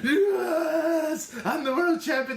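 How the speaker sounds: high, loud mock victory cry; hammed-up joy at winning the title; a touch of whiny mock triumph; mock celebration. Sting celebrating, retold.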